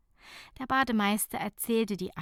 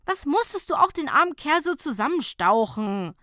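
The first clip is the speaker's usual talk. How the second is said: higher, louder grumble; grumbling reproach; 7 semitones higher, 5 dB louder than usual. A narrator's reading. Nicola grumbling at Fabienne in the car, sulky reproach. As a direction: grouse at her friend. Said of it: "maulte Nicola".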